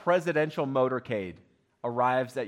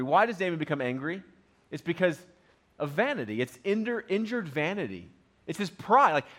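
usual speaking voice

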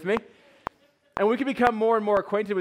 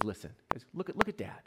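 calm hushed mom; 8 semitones higher, 6 dB quieter than usual. Retold or imitated. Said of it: "Mom looks at him and says".

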